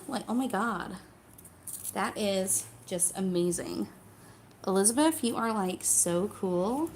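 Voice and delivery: exaggerated Californian American gush; Californian accent. Shown as a demonstration. Mocking how everybody does American, Californian accent. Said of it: "goes straight for, like, California".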